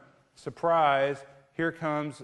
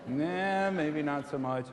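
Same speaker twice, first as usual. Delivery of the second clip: normal talking voice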